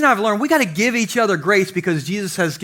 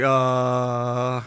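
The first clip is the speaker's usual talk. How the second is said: stunned grunt; 8 semitones lower, 5 dB quieter than usual. Retold or imitated. Wife's stunned reply to I love you.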